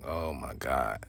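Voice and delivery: barely voiced shock to himself; under his breath. Kid in the car overhearing the call, stunned and hushed. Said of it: "And I'm like".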